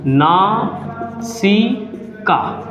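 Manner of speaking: नासिका stretched out very slowly, each sound drawn long; slow dictation. Shown as a demonstration. Teacher to students, dictating the new term very slowly. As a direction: spell out नासिका slowly for the class to copy. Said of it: "क्या बोलते हैं?".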